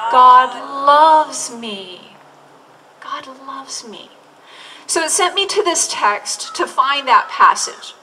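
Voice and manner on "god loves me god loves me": slow affirmation. Shown as a demonstration. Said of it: "Can you say it again".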